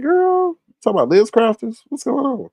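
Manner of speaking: put-on high-pitched, incredulous "girl"; mock bafflement. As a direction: question her in high, playful disbelief. Acted baffled reply to Kwani.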